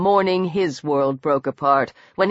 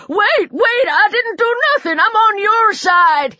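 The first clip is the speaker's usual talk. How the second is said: high, panicked pleading voice; piping; 11 semitones higher than usual. A narrator's reading. Little man warding off a charging mob, piping. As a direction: plead in a much higher pitch. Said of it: "he piped".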